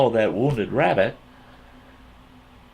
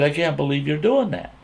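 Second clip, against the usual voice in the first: high-pitched incredulous reproach; scolding in disbelief; retold disbelief; 7 semitones higher than usual. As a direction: reenact being appalled at a friend. Retold shocked reproach to friend.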